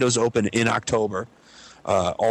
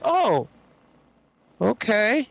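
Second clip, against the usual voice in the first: put-on high, uneasy old-lady voice; the neighbor drawing back, pitched up; 11 semitones higher, much slower than usual. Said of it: "She's like".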